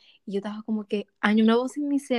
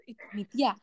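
normal talking voice

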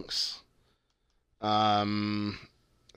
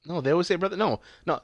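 ordinary talk, nothing marked

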